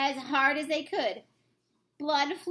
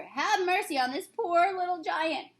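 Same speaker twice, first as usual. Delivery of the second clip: high, pitiful pleading; begging; 6 semitones higher than usual. Acted. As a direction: beg in terror. Giant begging Jack for mercy.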